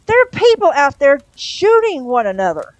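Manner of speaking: loud, high-pitched reproach; alarmed scolding. Acted. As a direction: raise your voice in outraged alarm. Angel scolds demon over shooting.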